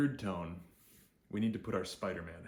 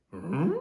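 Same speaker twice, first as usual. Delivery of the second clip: loud questioning hum swooping up; puzzled cartoon-dog "hmm?" rising high; confused Scooby-Doo hum; 9 semitones higher, 12 dB louder than usual. Teacher imitates confused Scooby-Doo, rising hum. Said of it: "he has a sound that he makes".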